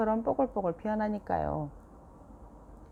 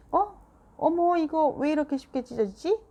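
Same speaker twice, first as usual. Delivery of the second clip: startled higher gasp of dismay; surprised; 10 semitones higher, 4 dB louder than usual. A narrator's reading. Mom surprised the paper tore so easily. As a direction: caught off guard by the tear.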